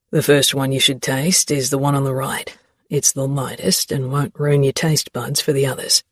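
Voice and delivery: a hint of a teasing young man; Xavier voice. A narrator's reading.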